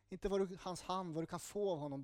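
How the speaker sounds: ordinary talk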